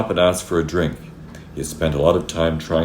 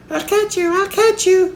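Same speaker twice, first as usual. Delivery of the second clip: put-on very high, playful child's call; squeaky little-girl chasing call; 6 dB louder than usual. A narrator's reading. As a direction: sing out high as if chasing a butterfly. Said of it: "she called".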